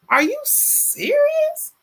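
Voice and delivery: very high, slow incredulous squeal; surprised. Real, not acted. Host reacting to chat, high slow disbelief.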